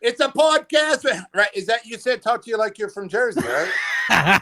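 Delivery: laughing through a mock Jersey accent; terrible Jersey accent. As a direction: play a New Jersey guy badly. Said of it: "That was a terrible Jersey accent".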